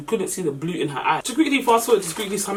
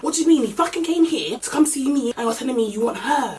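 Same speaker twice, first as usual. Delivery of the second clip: raised, higher indignant outburst; acting out mad; 7 semitones higher than usual. Chips confronting the guy who came to see her, acting out mad. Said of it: "Chips went mad".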